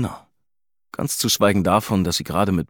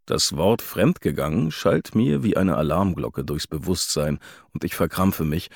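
plain reading voice

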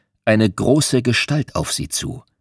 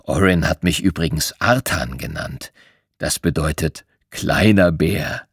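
lower, calm bear; lower, unhurried bear telling his past; 7 semitones lower, 3 dB quieter than usual. A narrator's reading. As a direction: speak as a gentle old bear. Bear character speaks.

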